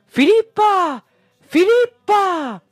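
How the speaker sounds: high, drawn-out shout; cries from boats. A narrator's reading. Crowd in approaching boats calling the tartana's name, long high shouts. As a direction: call the name far across the water, high and long. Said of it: "si coglieva soltanto il nome".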